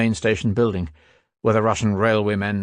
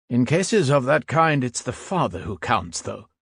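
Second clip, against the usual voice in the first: lightly voiced young soldier, a bit raised; a hint of an irked young soldier pleading his case; young soldier, defensive; 5 semitones higher than usual. A narrator's reading. Young German soldier answering the old soldier's doubt, lightly voiced and defensive.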